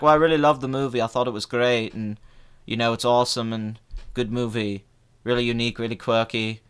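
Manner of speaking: lightly mimicked, low-key empty praise; bland podcaster impression. Critic imitates podcast host with nothing to say, bland low delivery.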